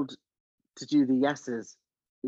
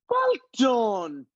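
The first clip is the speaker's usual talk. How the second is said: loud, high mock praise; shouted congratulation; 10 semitones higher, 6 dB louder than usual. Casting director and writer ending the audition, high mock shout. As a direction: call it out high and long.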